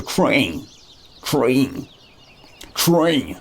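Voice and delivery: "train" twanged into "tryng"; mock "train" with a sliding "eye" vowel; "train" ending in an exaggerated ng; mocked mispronunciation. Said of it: "You probably say something like train".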